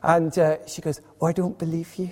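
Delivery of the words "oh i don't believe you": lightly voiced old Scottish lady; imitated old lady's accent. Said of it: "forgive my accent".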